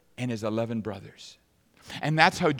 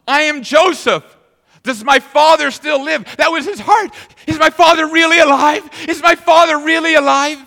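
overwhelmed cry; 14 semitones higher, 14 dB louder than usual. Acted. Joseph revealing himself and asking his brothers about father, loud overwhelmed cry. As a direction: cry out the revelation and the question.

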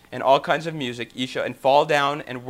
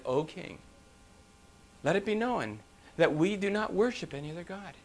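softened, respectful plea to a king; gently deferential refusal; submissive reply; 3 semitones higher, 6 dB quieter than usual. Retold or imitated. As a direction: state it humbly, without challenge. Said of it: "they spoke it with submission".

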